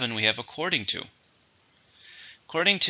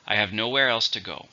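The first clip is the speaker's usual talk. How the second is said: usual speaking voice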